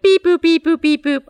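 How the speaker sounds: high beep boop robot noises. Retold or imitated. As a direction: chirp out robot beeps. Imitating the robot bit.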